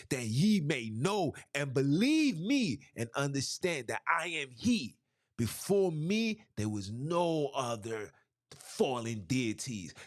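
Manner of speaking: impassioned street sermon, rising and falling; full-force preaching. Acted. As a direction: preach it to the congregation with fire. Preaching with scripture readings.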